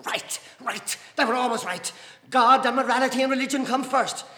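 loud, high insistence, building; excited fury. A narrator's reading. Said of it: "seeing her excitement".

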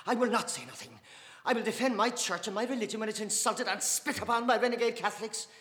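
loud, high, fierce defiance; loudly. Acted. Dante defending her church against the men, loud. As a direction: declare it to the whole table. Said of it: "Dante said loudly".

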